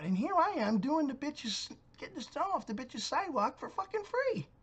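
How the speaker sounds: indignant complaint. Real, not acted. Man ranting about clearing her sidewalk for free, high and outraged. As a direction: pitch up in disbelief at the ingratitude.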